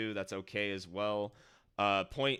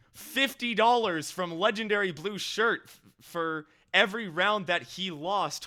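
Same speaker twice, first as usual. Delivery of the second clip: breathless excitement; 8 semitones higher, 8 dB louder than usual. Real, not acted. Stunned by the donation.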